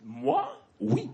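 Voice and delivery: coy and flirty, in silly French; mock French coyness. Playful reply in French.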